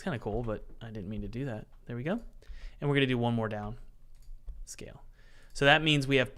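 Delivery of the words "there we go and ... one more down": normal talking voice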